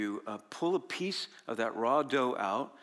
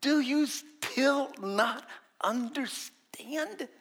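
very slow, high exasperated question; Jesus pressing the disciples, very slow and high; 10 semitones higher, much slower than usual. Retold or imitated. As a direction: stretch every word in disbelief. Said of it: "He said to them".

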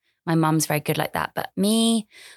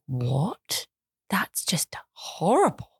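shocked whisper; much slower than usual. Retold or imitated. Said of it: "I've been like".

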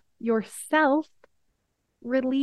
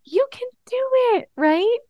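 much higher, bright encouraging cheer; 12 semitones higher, 4 dB louder than usual. Retold or imitated. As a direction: cheer like a nurse rooting for a kid. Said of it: "cheer him on".

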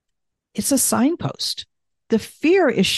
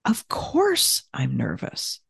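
whispered, slow and gentle; soft comforting whisper; 6 semitones lower, 4 dB quieter than usual. Shown as a demonstration. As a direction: breathe it softly to yourself. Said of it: "It might sound like this".

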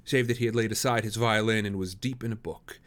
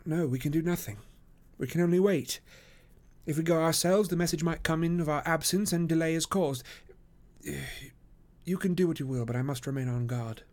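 higher-pitched detective voice; Holmes, resolute; 7 semitones higher than usual. Acted. Detective tells his friend he must wait, lightly resolute. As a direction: lift the pitch for a firm detective.

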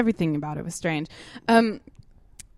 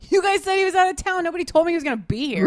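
high-pitched retold complaint; acted-out defensive exasperation; indignant protest; 8 semitones higher, faster than usual. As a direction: protest to your friends, voice pitched way up. Retelling her protest to friends.

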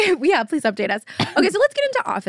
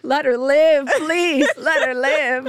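praying mom; 4 semitones higher, slower than usual. Retold or imitated. Mom speeding to her daughter, praying aloud, pleading. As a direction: beg God like a mom speeding to her kid. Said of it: "she's praying to God".

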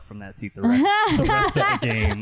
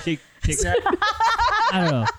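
high, laughing through the words; giggling it out; 4 dB louder, slower than usual. Real, not acted. Laughing while fumbling the name.

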